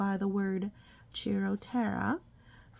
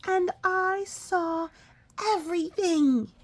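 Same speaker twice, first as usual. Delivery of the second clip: put-on high, slow, louder child voice; high little-bat voice; 10 semitones higher, 5 dB louder, slower than usual. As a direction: tell mama proudly as a tiny bat. Baby bat voiced in story.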